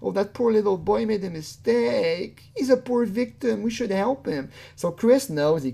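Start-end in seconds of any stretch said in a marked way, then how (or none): 0.0-4.5 high-pitched mock sympathy; mock pity. Voicing viewers who would pity him, mock sympathy. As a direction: imitate people fawning over a poor boy. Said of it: "people are gonna feel bad for him".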